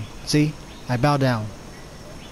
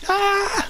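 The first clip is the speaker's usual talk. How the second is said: short startled yelp. Real, not acted. Startled in game.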